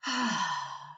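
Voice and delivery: long audible exhale; demonstrative breath let out; sigh. Shown as a demonstration. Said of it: "and sigh".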